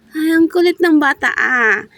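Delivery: ordinary delivery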